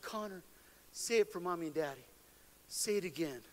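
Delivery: soft, low bedside voice; gentle coaxing. Retold or imitated. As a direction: coax a child quietly to repeat it. Mother coaxing son at bedside.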